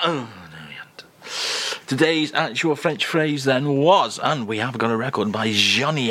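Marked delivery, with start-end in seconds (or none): 0.1-1.9 soft whispered aside; hushed aside. Real, not acted. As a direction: duck down and whisper it. Aside as Charles lingers.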